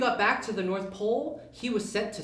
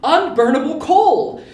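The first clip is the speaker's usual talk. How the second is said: much louder triumphant cry; excited shout; 6 semitones higher, 13 dB louder than usual. Acted. Yubi answering Derek with a triumphant shout. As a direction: announce it proudly. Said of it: "repeated louder".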